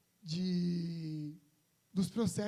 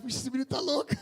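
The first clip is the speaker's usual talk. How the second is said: imitando a avó entre risos, mais alto; avó rindo; 4 semitones higher, 6 dB louder than usual. Retold or imitated. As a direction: fala da avó zombando, rindo. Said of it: "minha avó vai ficar". (neto imita a avó rindo dele)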